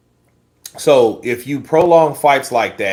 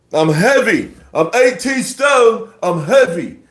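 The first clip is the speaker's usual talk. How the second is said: put-on British accent, much higher, slow shouted stone; British accent impression; 9 semitones higher, 4 dB louder, slower than usual. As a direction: ham up a British boxer insisting he's heavy. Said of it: "That accent had me crying".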